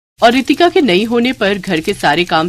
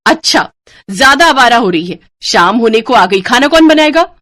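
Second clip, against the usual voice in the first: loud, high scolding taunt; angry taunting; 4 semitones higher, 6 dB louder than usual. Acted. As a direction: tear into her for idling. Babita scolds Ritika.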